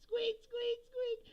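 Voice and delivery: very high squeak, imitating tank tracks; squeaky tank noise. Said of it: "the tanks were squeaking".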